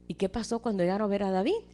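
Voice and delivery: ordinary talk, nothing marked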